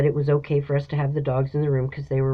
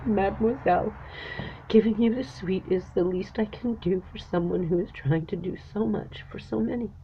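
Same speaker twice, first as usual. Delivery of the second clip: voice breaking; 3 semitones higher, 4 dB quieter than usual. Real, not acted. Retelling the manager's kindness, moved.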